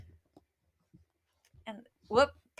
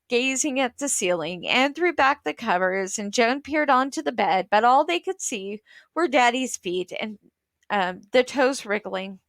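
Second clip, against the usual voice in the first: ordinary delivery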